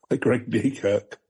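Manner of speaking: usual speaking voice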